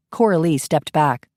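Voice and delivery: ordinary delivery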